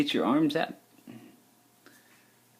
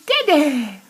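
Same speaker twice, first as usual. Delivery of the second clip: coaxing call; 13 semitones higher, 3 dB louder than usual. Real, not acted. Coaxing baby.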